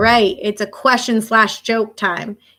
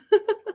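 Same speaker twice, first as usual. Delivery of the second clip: light laugh; 12 semitones higher, 3 dB quieter than usual. Real, not acted. Laughs at own joke.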